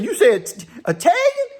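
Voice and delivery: high-pitched, incredulous double take; incredulous question. Retold or imitated. Jim questions Al about being Italian, incredulous. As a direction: repeat it back in high disbelief. Acts out Jim's startled question.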